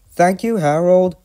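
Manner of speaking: high loud whistling call; whistled. A narrator's reading. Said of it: "he whistled".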